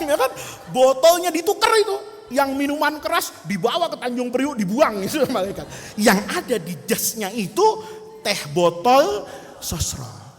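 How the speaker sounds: ordinary delivery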